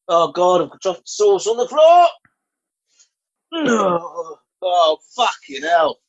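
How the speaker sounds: high, loud flustered yelps; real panic over a spill; panicked dismay. Real, not acted. Sauce spilled on bed.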